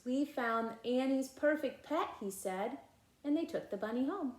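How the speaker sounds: put-on lively read-aloud voice for children; animated storybook reading with character lines; story narration. A narrator's reading. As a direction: read it like a bedtime picture book. Reading picture book aloud.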